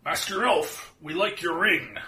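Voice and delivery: dwarf voice. Acted. Dwarf voiced addressing elf.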